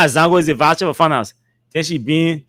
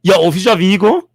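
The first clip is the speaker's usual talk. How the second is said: ordinary delivery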